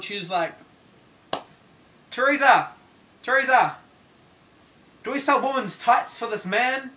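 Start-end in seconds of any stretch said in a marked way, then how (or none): 2.1-3.8 loud, higher yell across the store; yelling a name. Retold or imitated. Shop assistant calling her workmate across the store, loud yell. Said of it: "And she just yelled". 5.0-6.9 shouted across the store. Retold or imitated. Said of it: "And she just yelled".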